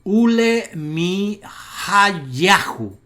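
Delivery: "Ule Mi Hayahu" loud and very slow, syllable by syllable; stress on YA. Shown as a demonstration. Teacher reading the accented name, loud slow syllables. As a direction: spell out "Ule Mi Hayahu", landing on "ya". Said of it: "el acento en la sílaba que tiene la Yod".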